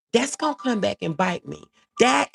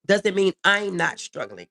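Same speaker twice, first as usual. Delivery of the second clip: normal talking voice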